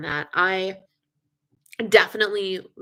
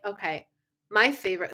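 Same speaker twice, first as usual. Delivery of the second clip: usual speaking voice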